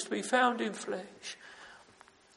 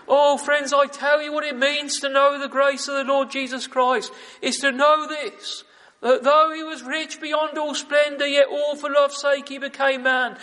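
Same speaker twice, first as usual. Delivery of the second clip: impassioned preaching; 3 semitones higher, 7 dB louder than usual. Acted. Preacher urging friends to know grace, fervently. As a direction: preach it out, rising.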